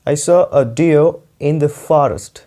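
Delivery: slow, clearly spaced words; slow, careful teacher read; slow dictation. Shown as a demonstration. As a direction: read it out slowly for students to copy. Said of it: "मैंने यहाँ पे एक sentence लिखा है".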